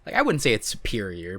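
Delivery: ordinary delivery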